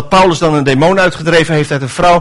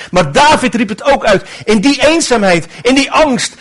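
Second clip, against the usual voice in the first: ordinary delivery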